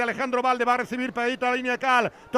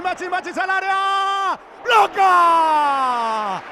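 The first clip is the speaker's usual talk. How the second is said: rising live call, louder; excited shout; 3 semitones higher, 5 dB louder, much slower than usual. Real, not acted. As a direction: shout the save as it happens. Save in the box, live call.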